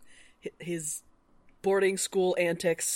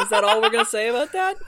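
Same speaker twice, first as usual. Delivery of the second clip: louder, fast, laughing through it; laughing tease; 4 semitones higher, 8 dB louder, faster than usual. Real, not acted. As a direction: tease them while giggling. Teasing repeat, louder, laughing.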